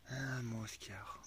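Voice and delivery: slow, whispered sigh at the dog; weary whisper. Real, not acted. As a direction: breathe it out, resigned. Sighing at the wet dog.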